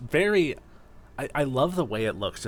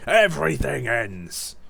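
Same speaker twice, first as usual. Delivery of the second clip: ominous Dusknoir; 4 dB louder, slower than usual. Retold or imitated. Recalling Dusknoir's villain line, slow ominous voice. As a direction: drag it out like a doom prophecy. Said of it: "my Dusknoir is supposed to be like".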